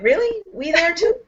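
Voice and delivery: surprised. Retold or imitated. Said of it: "I'm like".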